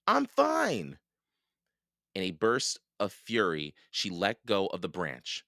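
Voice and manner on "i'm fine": high-pitched insistent cry; indignant shout. A narrator's reading. Annie protesting to the vulture, high indignant cry. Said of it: "Annie shouted at the vulture".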